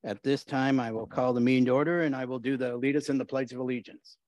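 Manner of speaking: usual speaking voice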